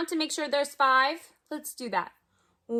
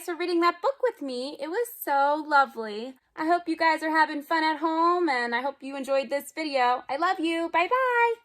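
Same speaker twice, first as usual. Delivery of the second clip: usual speaking voice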